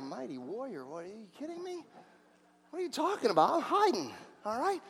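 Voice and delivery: hushed, disbelieving reply; fearful Gideon. Acted. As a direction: answer very softly, as if hiding. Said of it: "And he's like".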